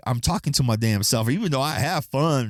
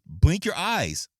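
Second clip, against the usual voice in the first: mock scolding; 7 semitones higher than usual. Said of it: "I typed on there".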